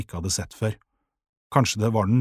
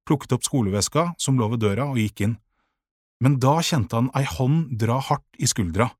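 ordinary delivery, nothing marked

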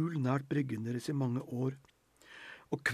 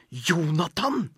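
higher, louder, slow hissed name; hissed rebuke; 4 semitones higher, 7 dB louder, slower than usual. A narrator's reading. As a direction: hiss his name in outrage. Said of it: "hveste hun".

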